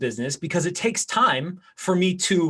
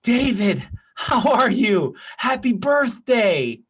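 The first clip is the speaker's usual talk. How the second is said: slow, higher, cheery greeting on the phone; friendly birthday call; 5 semitones higher, slower than usual. Shown as a demonstration. Agent calling a client on his birthday, slow and upbeat. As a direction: greet him slowly like you mean it. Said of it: "That feels a whole hell of a lot better".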